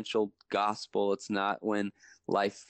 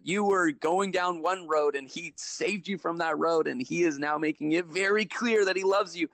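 higher, earnest prophetic declaration; retold prophesying; 8 semitones higher than usual. Nurse retelling her prophecy to the patient, earnest and higher. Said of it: "And I start prophesying".

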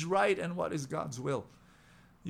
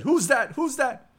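high, loud frightened call into the dark; startled calling out; 12 semitones higher, 9 dB louder than usual. Acted. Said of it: "And the tourist said".

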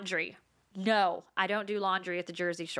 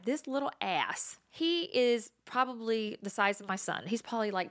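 usual speaking voice

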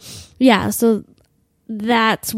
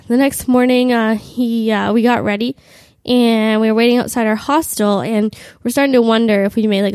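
ordinary talk

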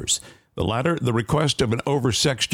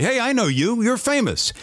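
much higher-pitched mock fan; starstruck fan; 13 semitones higher than usual. Friend hailing a famous comedian across a restaurant, mock starstruck. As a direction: imitate an eager friend spotting a celebrity. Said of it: "as if to say".

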